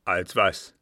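put-on loud old captain; commanding interrogation; gruff demand. A narrator's reading. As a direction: fire the question at him.

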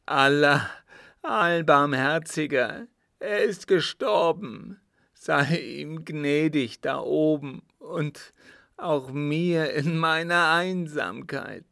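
high, loud, drawn-out wail of grief; loud sobbing lament. A narrator's reading. Son praying over his dead father, loud sobbing lament. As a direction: cry out in anguished prayer, slowly. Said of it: "unter lautem Schluchzen gesprochen".

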